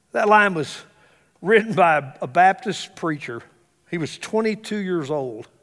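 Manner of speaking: ordinary delivery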